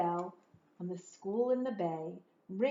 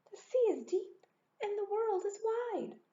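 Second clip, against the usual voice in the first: high, dreamy little snail; the tiny snail longing for the sea; 12 semitones higher than usual. Acted. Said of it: "said the tiny snail".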